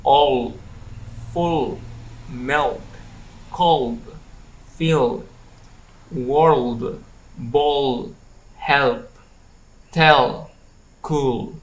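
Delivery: word list "all" to "cool", drawn-out dark L, falling; long dark L. Shown as a demonstration. Teacher models words for learners, long dark L. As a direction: lengthen the dark L, as at the end of "all". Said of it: "more abstract and long sound".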